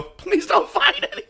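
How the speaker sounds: high, giggling mock prayer; laughing plea. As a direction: beg the scanner while cracking up. Bag going through customs again.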